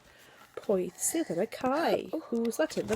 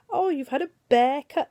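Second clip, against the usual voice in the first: high, loud mocking sing-song; a poem reader voicing the teasers; teasing classmates; 6 semitones higher, 6 dB louder than usual. A narrator's reading. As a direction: tease like a schoolchild.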